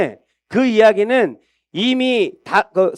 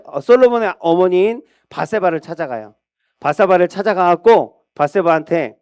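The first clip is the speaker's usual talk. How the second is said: usual speaking voice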